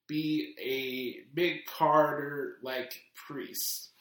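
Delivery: ordinary talk, nothing marked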